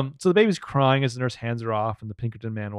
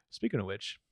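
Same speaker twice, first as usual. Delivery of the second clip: usual speaking voice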